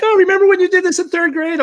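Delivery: high-pitched mock mom voice; imitated gushing mom. Imitates his mom reminiscing.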